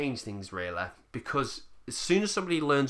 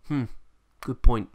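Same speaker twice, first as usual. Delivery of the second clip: a hint of a pondering viewer, hmm then louder; mock thoughtful viewer; 9 dB louder than usual. Viewer mulling over the rant, mock musing. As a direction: play the viewer who's just been convinced. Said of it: "take a little nugget from that and go".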